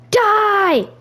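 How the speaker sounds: lightly yelled attack cry, imitating a game character; mock battle yell. Stroll uses an ability in combat, imitated mild yell. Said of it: "could use an ability and yell".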